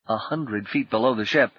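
usual speaking voice

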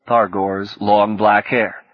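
ordinary delivery, nothing marked

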